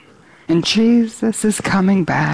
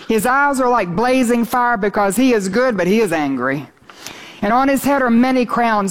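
higher, ringing reading of the passage; exultant scripture reading; 4 semitones higher than usual. Real, not acted. Preacher reading Revelation to his church, exultant. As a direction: proclaim the text with joy. Reads scripture aloud, raised.

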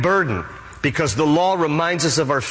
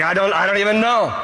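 higher, hurried, lightly acted confusion; mock bewilderment; 6 semitones higher, faster than usual. Thief answers angel at heaven's entrance, mildly bewildered. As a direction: play a mildly flustered newcomer.

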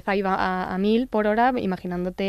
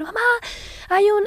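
put-on child's night-terror scream; 13 semitones higher than usual. Mother re-enacts her son's night terror, screaming. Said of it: "se puso a gritar".

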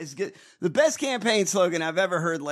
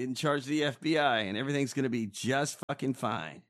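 ordinary delivery

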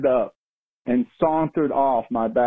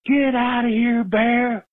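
renewed yell at a bear; 10 semitones higher, slower than usual. Retold or imitated. Caller yells again at the trash bear, now high and forceful. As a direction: really let the bear have it. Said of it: "went back out there again with renewed vigor".

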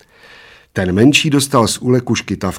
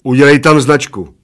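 loud, raised order; commanding; 5 semitones higher, 8 dB louder than usual. A narrator's reading. Bald giant ordering the man on his shoulders, commanding. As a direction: order it done at once. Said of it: "poručil Plešatec".

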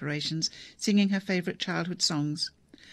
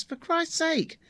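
exasperated; 12 semitones higher, 3 dB louder, much slower than usual. Acted. Deb disgusted at father.

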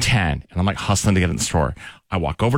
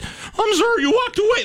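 squeaky apology, very much higher, breaking; voice cracking. Retold or imitated. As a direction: let 'walked' crack way up high. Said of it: "my voice cracked too".

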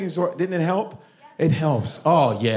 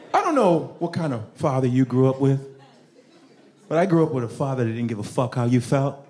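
usual speaking voice

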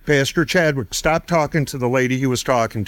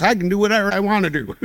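imitating the wife's defiance, high and fast; mock-defiant high rapid retort ending in a laugh; 7 semitones higher, faster than usual. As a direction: toss it off like a stubborn wife, up high and quick, then laugh. She started cursing out God.

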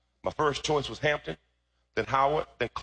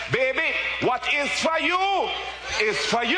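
put-on high, loud Jamaican-accented preaching; mother's Jamaican accent; 13 semitones higher, 4 dB louder, slower than usual. Preacher quoting his mother to the church, Jamaican accent. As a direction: belt out mum's saying in a Jamaican accent. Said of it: "in her Jamaican accent".

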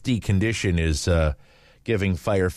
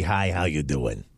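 a hint of a smoker's voice, slightly lower; lightly imitating a raspy smoker's greeting; Lucy's raspy morning voice; 4 semitones lower than usual. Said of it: "this is my impersonation".

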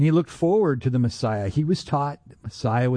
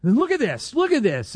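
high-pitched excited calling over; 7 semitones higher than usual. Retold or imitated. Soldiers calling over another officer, excited. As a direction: imitate someone excitedly showing a find. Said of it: "they're getting all excited".

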